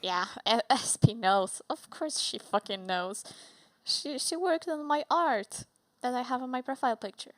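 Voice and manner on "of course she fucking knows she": usual speaking voice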